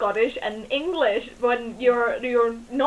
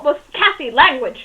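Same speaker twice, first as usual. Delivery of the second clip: louder mock scolding through a laugh; 2 semitones higher, 7 dB louder than usual. Shown as a demonstration. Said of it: "I say that a lot".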